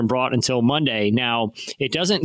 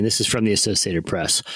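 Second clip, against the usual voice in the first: usual speaking voice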